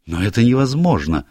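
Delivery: secretary crying out in protest; exclaiming. A narrator's reading. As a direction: blurt it out in alarm. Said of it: "вскричал".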